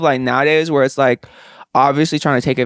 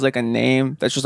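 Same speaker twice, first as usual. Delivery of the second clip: ordinary talk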